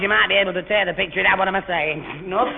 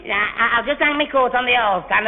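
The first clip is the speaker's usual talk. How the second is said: ordinary talk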